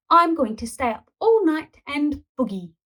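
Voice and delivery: loud, high cheeky boast; defiant boast. A narrator's reading. Boy defying granny at the disco. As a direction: brag it defiantly.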